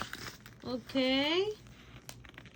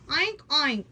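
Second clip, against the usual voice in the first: pig noise; 3 semitones higher, 7 dB louder than usual. Pretend. Mother voicing pig for toddler.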